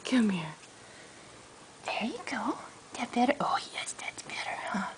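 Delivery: hushed soothing whisper. Real, not acted. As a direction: whisper to settle a scared pet. Calming a shivering pet.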